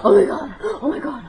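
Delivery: high, loud frantic inner scolding; panicked self-scolding. Retold or imitated. Scolding herself in the elevator, loud and frantic. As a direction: berate yourself in a panic.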